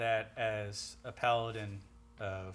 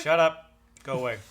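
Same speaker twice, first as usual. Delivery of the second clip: annoyed; 3 semitones higher, 13 dB louder than usual. Acted. Player snaps at the seller.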